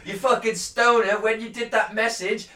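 high, loud mock telling-off; mock scolding shout. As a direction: berate the stoner, slowing down. Scolding the space hippie.

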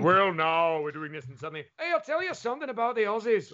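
imitating the Scottish woman who heckled, with a light Scottish lilt; mock Scottish accent. Comedian mimics old Glaswegian heckler, in a Scottish accent. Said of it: "I'm just there going".

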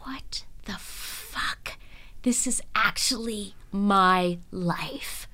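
whispered stunned disbelief, slow; awed whisper. Retold or imitated. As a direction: breathe it out slowly in shock. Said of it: "you're like".

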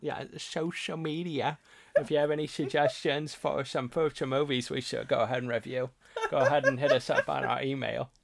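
slurred sh-sounds in a goofy announcer voice; loud, goofy voice, mushing S into SH; silly put-on voice. Co-host plugging social media and email, silly put-on voice. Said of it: "What is that voice?".

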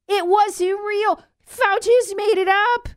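high, slow, drawn-out disbelief; mocking high exclamation; incredulous. Real, not acted. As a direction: stretch it out in outrage. Outraged punchline.

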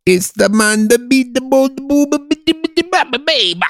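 high mock-Italian babble; Italian accent. Shown as a demonstration. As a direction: rattle off cartoon Italian nonsense. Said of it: "that shit was Italian".

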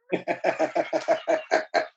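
long burst of laughing; real laughter. Real, not acted. Teller amused by the wolf story, real laughter. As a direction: laugh freely after the punchline.

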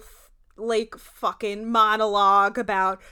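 ordinary delivery

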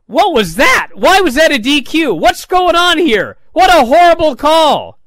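high, loud outraged yell; imitating a screaming announcer. Corey Graves screaming at the referee's call, outraged. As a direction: blast the bad call like a furious commentator. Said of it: "Corey Graves starts screaming".

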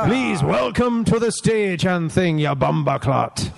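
slow, put-on Jamaican stage announcement; Jamaican accent. Comedian welcoming someone to the stage, mock Jamaican accent. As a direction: introduce the act in a hammed-up Jamaican accent. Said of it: "in a Jamaican accent".

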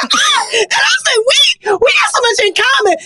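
high, excited squeal of recognition; retold giddy excitement. Telling how two new friends clicked, giddily excited. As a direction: gush it high, acting out the thrill. Retold thrill at finding common ground.

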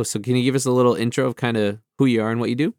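ordinary talk

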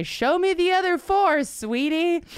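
high, loud teasing voice; flirty catcall. Pretend. Catcalling James Corden's cat on screen, high flirty voice. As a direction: purr it like a flirty heckler. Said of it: "you can say".

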